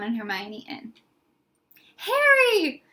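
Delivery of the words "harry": excited cry. A narrator's reading. Friend rushes to bedside.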